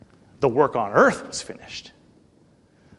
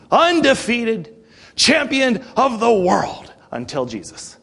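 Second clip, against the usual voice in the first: rising, louder sermon build; fiery preaching; 3 semitones higher, 3 dB louder than usual. Acted. Preacher drives home Satan's defeat, in a rising fervent preaching voice.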